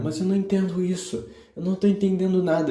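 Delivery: high-pitched retold protest, speeding up; whiny child complaint. Child telling aunt he doesn't understand, high frustrated voice. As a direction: pitch it up like a frustrated kid pleading.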